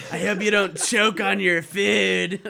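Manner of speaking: loud, slow, mocking menace; sinister villain voice. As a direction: threaten with fake politeness. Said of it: "talking like a sinister villain".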